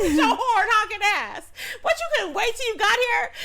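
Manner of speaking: high, loud, laughing rant; mock telling-off. Telling off the honking driver, loud high mock outrage. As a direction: keep berating them loudly through laughter.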